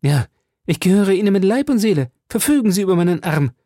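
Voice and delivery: fast, high-pitched ardent pledge; glühende Hingabe. A narrator's reading. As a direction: rush out an eager declaration of devotion. Ardent pledge to his beloved. (begeistert und schnell beteuert)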